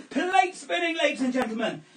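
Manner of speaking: high, loud trick title; showman announcer. Acted. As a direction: present it grandly. Announces plate spinning.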